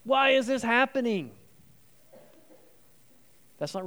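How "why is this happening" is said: high, loud "God, why?" in protest; accusing cry. Retold or imitated. A struggling believer protesting to God with a raised fist, anguished. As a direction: shake your fist at heaven and cry out. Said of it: "shake our fist and go".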